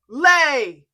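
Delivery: huge loud roar at the goose; roaring command. Acted. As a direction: bellow the order.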